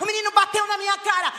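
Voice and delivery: outraged shout. Shown as a demonstration. Mother outraged at child hitting.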